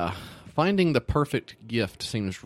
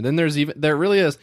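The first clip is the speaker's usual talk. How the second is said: ordinary talk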